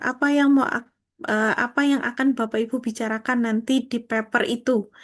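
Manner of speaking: ordinary talk, nothing marked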